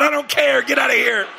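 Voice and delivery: high, loud retold yell; yelling at kids. Father yelling at kids to get downstairs, high loud yell. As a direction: bark orders like an angry dad. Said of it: "I said".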